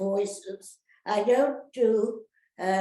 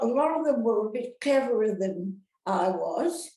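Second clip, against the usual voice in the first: ordinary talk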